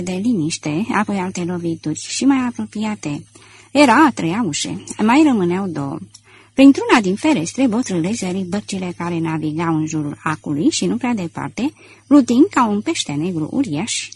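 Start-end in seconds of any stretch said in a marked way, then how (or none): none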